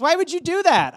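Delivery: very high-pitched mock indignation; high, imitated female protest; upset girl. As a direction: mimic her wounded demand, voice shooting up. Girl confronts him, acted out.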